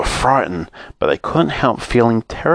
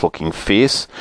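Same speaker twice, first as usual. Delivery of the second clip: plain reading voice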